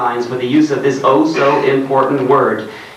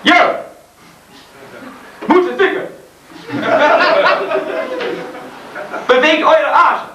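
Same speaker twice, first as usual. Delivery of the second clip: mock rock frontman. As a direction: ham up a German band singer greeting the crowd. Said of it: "introduce every song with".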